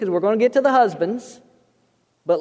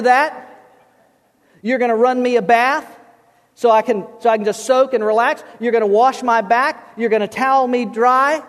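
raised, emphatic voice of a demanding husband; bossy husband; 3 semitones higher than usual. Acted. Guy who read the book orders his wife, bossy. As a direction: hand out orders, puffed up as head of the house. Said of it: "and he says".

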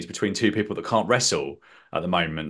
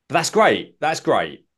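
raised, louder enthusiastic exclamation; excited; 5 semitones higher, 4 dB louder, slower than usual. Real, not acted. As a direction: burst out with real enthusiasm. Excited approval.

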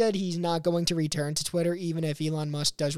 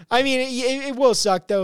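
higher and louder, breaking into laughter; laughing; 7 semitones higher, 7 dB louder than usual. Real, not acted. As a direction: laugh through the words. Laughs mid-rant.

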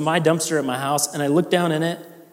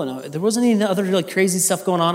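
ordinary talk

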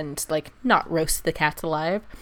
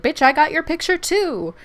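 raised, higher voice firing back; defiant call-out; 9 semitones higher, 6 dB louder than usual. Retold or imitated. Pregnant woman snapping back at the angry lady, defiant and loud. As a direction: throw it back at her, loud and high. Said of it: "she's like".

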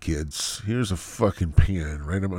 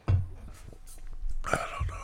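slow whisper; 5 dB quieter, much slower than usual. Real, not acted. Trailing off to himself.

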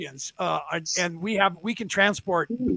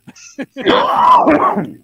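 high, loud shrieking laugh; loud cackle; 12 semitones higher, 11 dB louder than usual. Real, not acted. He cracks up at his own story with a loud high cackle.

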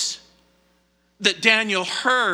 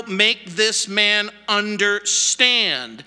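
slow, deliberate order landing on "understand"; emphatic command; slower than usual. Acted. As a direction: stretch "understand" out, syllable by syllable. Preacher quotes the repeated command.

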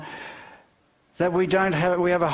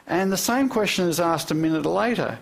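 ordinary talk, nothing marked

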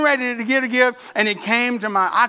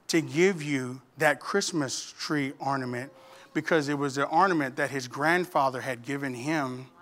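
gentle; 8 semitones lower, 9 dB quieter than usual. Retold or imitated. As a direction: tell it tenderly with careful pauses. Teacher reveals grandfather's ornament story.

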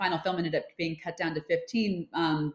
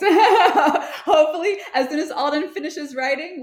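laughing; 11 semitones higher, 8 dB louder than usual. Real, not acted. Laughs after praise of film.

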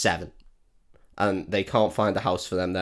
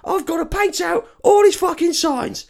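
high, strained mock rant; hammed-up paranoid grump; high put-on furious voice; mock angry painter; 5 dB louder than usual. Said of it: "he's just like".

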